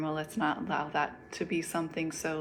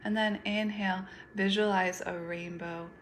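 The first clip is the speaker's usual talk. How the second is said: guided meditation. Acted. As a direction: lead them gently through each breath, unhurried.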